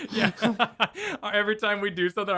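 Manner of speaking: talking through laughter; laughing. Real, not acted. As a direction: laugh as you say it. Laughing at the joke.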